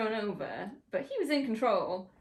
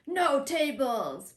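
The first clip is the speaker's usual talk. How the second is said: slow mock yell, a little higher and louder; mock shout; 5 semitones higher, 5 dB louder, much slower than usual. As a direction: drag out a hammed-up no tables. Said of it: "Roman goes to get something".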